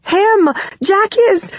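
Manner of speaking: high, loud panicked outburst; frantic acted cry; hysterical. A narrator's reading. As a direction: burst out high, then get cut off. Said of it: "I'm sobbing hysterically".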